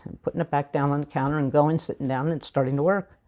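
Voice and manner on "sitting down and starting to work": ordinary delivery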